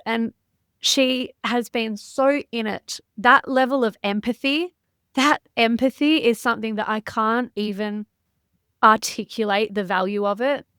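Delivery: ordinary delivery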